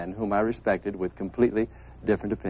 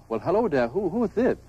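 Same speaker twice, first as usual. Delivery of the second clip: put-on a light German accent, pitched high on this; 9 semitones higher than usual. Caller with a German accent phoning Bob at home, lightly imitated. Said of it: "a man with a German accent said".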